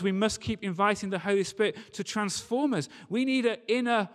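ordinary delivery

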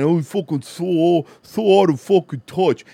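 slow, drawn-out sneering mimicry of Zoomers; mocking whine. Mocking the Zoomers who call him old, slow sneering whine. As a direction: stretch it out like a scornful parody.

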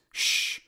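sharp whispered shh; a quick hissed shh to silence her; shushing. Acted. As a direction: hush her sharply under the breath. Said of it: "Doctor Simon shushed her".

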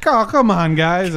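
high, loud retold plea; mock protest. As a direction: imitate the busted giggler protesting. Retold suspect being arrested.

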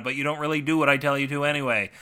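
ordinary delivery, nothing marked

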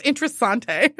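playful foreign-style "interessante"; mock-Italian pronunciation. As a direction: stretch "interessante" into four fancy syllables, ending -tay. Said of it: "She says".

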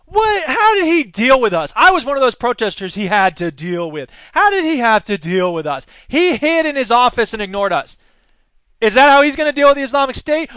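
indignant rant. Real, not acted. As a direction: fire back loudly in protest. Indignant rant against Walker.

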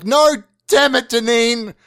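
high, loud, slow cry of frustration; anguished shout. Retold or imitated. Calling after Deneen as she storms off, loud anguished yell.

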